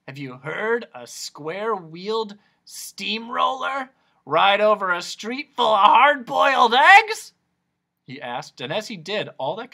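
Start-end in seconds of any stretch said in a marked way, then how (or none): 0.1-7.3 rising, excited question building louder; eccentric noise doctor. Acted. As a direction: build to a high, loud finish.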